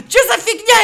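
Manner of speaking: high, loud, through laughter; amused, incredulous high outburst; laughing disbelief. Real, not acted. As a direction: blurt it out mid-laugh, baffled. Laughing at a baffling glitch.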